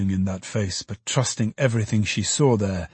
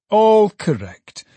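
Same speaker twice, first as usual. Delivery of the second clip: much higher, louder and slow; proudly announcing success; triumphant exclamation; 10 semitones higher, 6 dB louder, slower than usual. Acted. Trotty triumphant.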